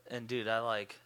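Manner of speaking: normal talking voice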